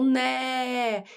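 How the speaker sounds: "né" held long, as if calling; slow, sustained demonstration of the syllable; drawn-out syllable. Shown as a demonstration. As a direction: stretch the open vowel of "né". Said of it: "você fica mais tempo gritando?".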